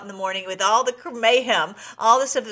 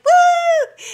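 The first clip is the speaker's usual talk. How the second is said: crowd cheer; 10 dB louder than usual. Retold or imitated. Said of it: "everyone was like".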